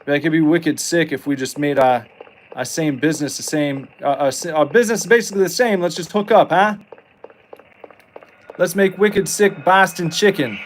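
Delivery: a hint of a Boston businessman, upbeat; Boston accent. Owner proposing a merger to rival chain, mock Boston accent. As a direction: pitch the merger like a Boston local. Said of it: "the owner of the other place was like".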